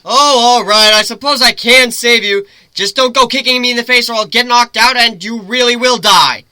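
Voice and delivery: loud, high call across the wind; griffin calling out. Acted. Griffin shouting to Lyra as she falls, high and loud. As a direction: shout advice down to her mid-fall. Griffin voiced, mock reluctant.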